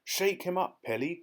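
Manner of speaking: eager cry. A narrator's reading. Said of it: "cried the Giraffe".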